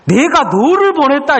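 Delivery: high, emphatic statement; God's declaration. Retold or imitated. God telling Gideon he was sent, high and commanding. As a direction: declare it with full authority. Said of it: "이렇게 이야기를 하시는 거예요".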